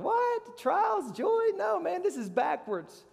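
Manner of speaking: squeaked high, baffled; high incredulous protest; mock disbelief. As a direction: act like it makes no sense. Mock shock at trials joy.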